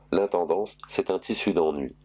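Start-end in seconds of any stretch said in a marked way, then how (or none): none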